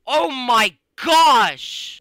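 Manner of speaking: loud, high, drawn-out gasp of shock; startled shout. Real, not acted. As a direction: belt it out in sudden shock. Startled by a game scare.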